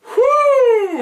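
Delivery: high, loud "whoo"; high-pitched whoo at a boozy shock; mock-stunned whoo. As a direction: let out a big, held whoop. Reacting to strong beer's percentage.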